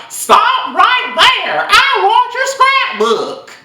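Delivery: put-on very high, slow country-accented teacher voice; mock the teacher's country drawl, halting the student. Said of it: "a little funny little country accent".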